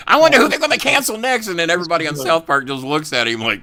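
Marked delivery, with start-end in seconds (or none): 0.1-1.5 high, loud bratty cartoon kid; Cartman impression. Quoting Cartman wondering who gets canceled in a high voice. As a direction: whine it like furious Cartman. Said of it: "Cartman was losing his fucking mind".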